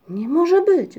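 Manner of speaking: high, loud outburst; bursting out. A narrator's reading. Muminek bursts out hearing about the hat, loud and high. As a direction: blurt it out in astonishment. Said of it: "wybuchnął Muminek".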